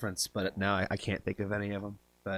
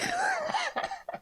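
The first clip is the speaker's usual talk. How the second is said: squealing laugh, way up high; high shocked laugh. Real, not acted. Streamer squeals at the instant correct guess. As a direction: burst out in a shrieking laugh.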